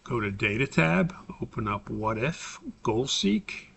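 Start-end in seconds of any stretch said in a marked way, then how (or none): none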